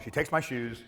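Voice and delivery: ordinary talk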